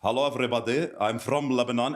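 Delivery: mimicking a newly arrived Lebanese accent; lightly put-on Lebanese accent, like a new immigrant; fresh-off-the-boat Lebanese accent. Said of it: "when I first was fresh off the boat".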